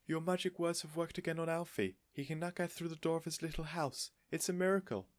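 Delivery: high, marvelling; amazed Mrs Silver. A narrator's reading.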